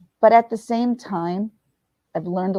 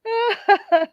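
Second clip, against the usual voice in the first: high laugh fit; 13 semitones higher than usual. Real, not acted. Laughing at own road rage.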